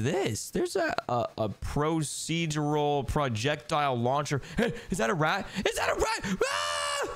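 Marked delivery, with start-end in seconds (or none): 5.7-7.1 shrill squeal of fright; high startled scream. Acted. Finding a rat in the vent, high scream. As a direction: shriek at the rat.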